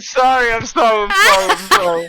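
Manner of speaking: high laughing sorry; laughing apology. Real, not acted. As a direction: apologize while cracking up. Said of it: "I do that when I'm laughing hard".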